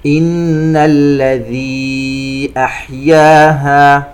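slow chant, long ee at the end of "الذي"; separated madd. Shown as a demonstration. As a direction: hold "الذي" four counts before "أحياها". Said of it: "مد منفصل يمد بمقدار أربع حركات". (ترتيل مع مد "الذي" أربع حركات)

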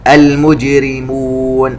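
المجرمون with a bouncing, echoing jim; qalqala on the jim. Shown as a demonstration. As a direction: let the jim in المجرمون rebound. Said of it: "نقلقل الجيم". (قلقلة الجيم في المجرمون)

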